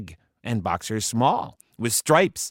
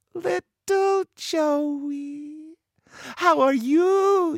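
very high, slow cooing; drawn-out, high-pitched fond greeting; doting big sister; much slower than usual. A narrator's reading. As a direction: coo at your baby brother. Sister greets long-lost brother.

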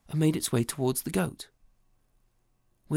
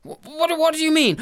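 high, stammering alarm; mock fright, higher; startled husband; 11 semitones higher, 8 dB louder than usual. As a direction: caught off guard and scared.

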